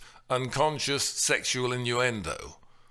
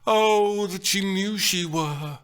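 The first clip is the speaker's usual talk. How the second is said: lovestruck Romeo; 7 semitones higher, 4 dB louder than usual. Acted. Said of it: "Romeo in the balcony scene exclaims".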